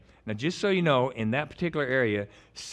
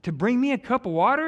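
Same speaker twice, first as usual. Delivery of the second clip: astonished disbelief; 11 semitones higher, 5 dB louder than usual. Retold or imitated. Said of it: "And David goes".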